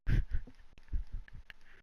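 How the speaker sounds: breathy, voiceless laugh; helpless fit of laughter. Real, not acted. Podcaster cracking up at own joke, laughing. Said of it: "I don't know why I'm laughing".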